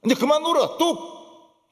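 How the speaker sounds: high, abrupt acted parent command; 부모의 호령. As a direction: tell a child firmly to stop crying. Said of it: "부모의 심정으로". (부모가 아이에게 높고 단호하게 이르듯)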